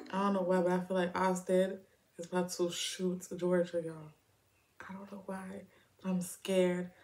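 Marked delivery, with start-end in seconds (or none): 4.9-6.9 hushed scared whisper. Real, not acted. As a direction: whisper it, frightened. Reacting to a tense scene quietly.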